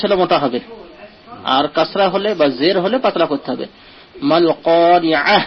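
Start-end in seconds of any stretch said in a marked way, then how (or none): 4.3-5.4 slow recitation. Shown as a demonstration. Teacher reciting the second verse. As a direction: recite মাল কারিয়াহ slowly. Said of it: "teacher recites the verse".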